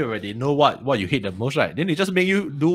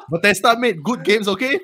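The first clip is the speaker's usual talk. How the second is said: laughing; 6 semitones higher than usual. Real, not acted. Teasing while laughing.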